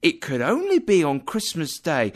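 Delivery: indignant. Acted. Wife insists Scrooge is mean.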